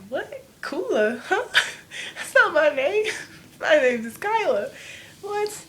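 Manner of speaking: high, loud, laughing protest; laughing disbelief. Retold or imitated. Teen corrects the clerk's mistake, laughing loudly. Teen hears her name wrong.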